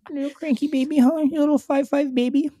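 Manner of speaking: high, cooing condescension; mock baby talk. Bagel seller babying short customer, high coo. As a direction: coo at him like a fussy baby.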